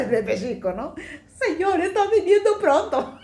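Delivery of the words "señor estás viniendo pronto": high, loud, laughing cry; laughing exclamation. Retold or imitated. Woman laughing that the Lord must be coming soon, high and loud. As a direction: burst out laughing at the thought. Joking about the Lord's return.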